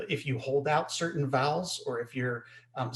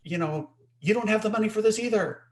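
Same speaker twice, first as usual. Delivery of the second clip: raised-pitch sympathetic outrage; put-on exasperated complaint, higher voice; mock indignation; 7 semitones higher than usual. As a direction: act angry along with them. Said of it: "you're angry about that as well".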